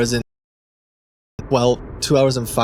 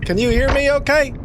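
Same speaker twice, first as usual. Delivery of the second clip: higher, eager caller voice; anxious caller voice; 13 semitones higher than usual. Pretend. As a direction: play a flustered phone caller. Plays a confused caller on phone.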